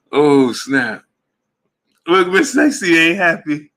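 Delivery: high, loud, laughter-filled exclamation; loud, high and tickled; cracking up. Real, not acted. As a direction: squeal it out in helpless amusement. Cracking up at a viewer's reaction.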